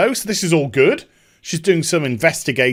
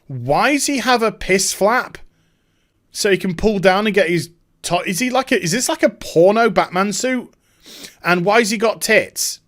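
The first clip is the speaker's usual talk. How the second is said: higher, incredulous questioning; mock disbelief; 4 semitones higher than usual. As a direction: act out baffled outrage at a costume. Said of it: "She can't believe what she's just seen".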